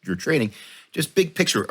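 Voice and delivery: ordinary talk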